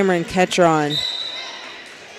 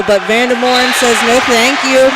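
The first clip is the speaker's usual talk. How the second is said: raised, higher play-by-play call; lifted, punchy sportscaster excitement; excited block call; 4 semitones higher, 4 dB louder than usual. Real, not acted. Calls a decisive block.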